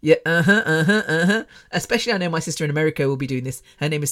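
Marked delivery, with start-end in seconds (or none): 0.0-1.4 mock knowing uh-huh. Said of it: "will be laughing, listening to this, going".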